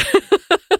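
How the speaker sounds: sharp high burst of laughter; cracking up. Real, not acted. Laughing at oil fire image.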